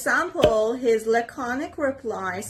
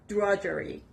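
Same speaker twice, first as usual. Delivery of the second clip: "drudgery" as DRUDG-ery, softer and falling; teacher's model "drudgery", front-stressed; stress on first syllable; 2 semitones lower, 6 dB quieter than usual. Shown as a demonstration. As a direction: hit DRUDG, let the rest of "drudgery" fall away. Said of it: "The stress is on the first part".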